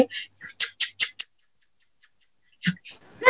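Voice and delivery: whispered chugging tuk tuk; train sound. Shown as a demonstration. Teacher showing children the train's sound, whispered. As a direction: breathe out the train's tuk tuk softly. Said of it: "रेल चलने की आवाज कैसे आती है".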